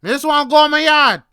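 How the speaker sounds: loud, high retold angry shout; snapped outburst. Tired walker snapping at the vendor, re-enacted angry shout. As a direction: snap back at a heckler, full volume. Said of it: "and I snapped. I'm like".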